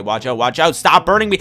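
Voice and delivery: ordinary talk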